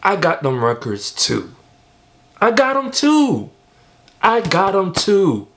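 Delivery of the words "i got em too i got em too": loud, high-pitched protest; excited insistence. Real, not acted. Fan telling [name] he owns those records too, excitedly insistent. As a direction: insist it, voice leaping way up. Playfully calls out [name]'s borrowed influences.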